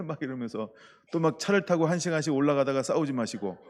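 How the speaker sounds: ordinary delivery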